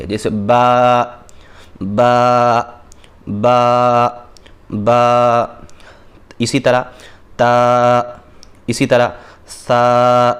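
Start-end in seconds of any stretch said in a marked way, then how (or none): 0.0-5.4 با held long and level, a slow sustained vowel; stretched ba. Shown as a demonstration. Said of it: "لیکن با میں تھوڑا کھینچیں گے". 7.4-10.3 تا and سا held long and level, slow; stretched ta and sa. Shown as a demonstration. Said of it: "تینوں کو ایک الف کے برابر کھینچنا ہے".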